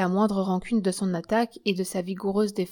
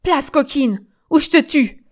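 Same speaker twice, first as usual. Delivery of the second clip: high, loud death threat; threatening shout; 6 semitones higher, 8 dB louder than usual. Acted. Germaine, dagger raised at the blocked exit, shouts a threat. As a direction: shout to force your way through.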